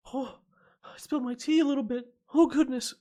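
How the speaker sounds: high, loud startled exclaim; surprised. Real, not acted. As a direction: yelp as the tea spills. Spilled tea mid-stream.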